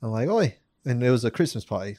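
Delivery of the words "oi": put-on British "Oi"; fake British accent. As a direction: call out in a mock British accent. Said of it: "You're just using a fake accent".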